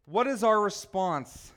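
normal talking voice

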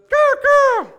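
very high, loud raven squawk; raven caw. Shown as a demonstration. Preacher demonstrates what exclaimed means, raven caw. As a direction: screech it out like a raven. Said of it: "to croak or caw like a raven".

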